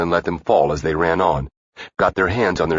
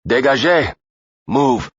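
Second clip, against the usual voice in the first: high, loud barked order; screamed command; 8 semitones higher, 4 dB louder, slower than usual. A narrator's reading. Chavez storms the balcony and screams at the Frenchmen, loud order. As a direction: scream it to freeze the room. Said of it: "Chavez screamed".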